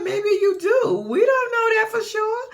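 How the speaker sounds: sassy comeback. Retold or imitated. Snapping back at Pumpkin.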